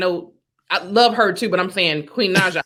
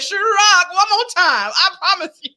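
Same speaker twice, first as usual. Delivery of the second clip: imitating [name]; 9 semitones higher than usual. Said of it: "if he said".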